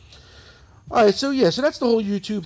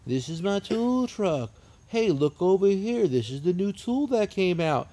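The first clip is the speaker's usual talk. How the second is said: mock slow, drawn-out vlogger greeting; tool truck video guy; 2 semitones higher, slower than usual. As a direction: drag it out like a dull video host. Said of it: "like this guy".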